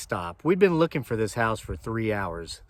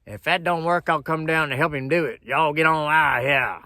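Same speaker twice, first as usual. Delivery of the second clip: put-on higher, louder threatening old hag; 5 semitones higher, 5 dB louder than usual. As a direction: bark it like a mean old woman backing him up. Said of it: "ugly old hag sitting beside him, says".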